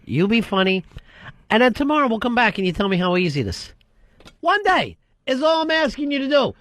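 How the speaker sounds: high, exasperated comeback; fed-up challenge. Acted. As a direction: dare the critic to do better. Acted argument with the inner voice.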